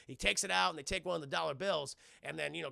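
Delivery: ordinary talk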